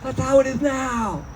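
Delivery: high stoner shout of realization; high excited stoner cry; stoner exclaiming. Retold or imitated. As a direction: yell it like a baked revelation. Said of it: "It's like".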